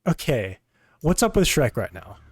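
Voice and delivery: ordinary talk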